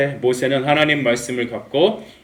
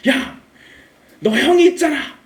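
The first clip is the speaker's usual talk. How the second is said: high, exasperated shout as God; hammed-up angry outburst; acted divine anger; 13 semitones higher, 4 dB louder than usual. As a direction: scold like God out of patience. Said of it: "너무 화가 나서 화내셨어요".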